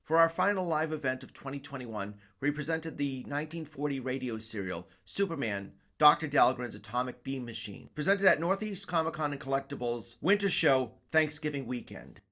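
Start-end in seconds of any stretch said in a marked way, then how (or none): none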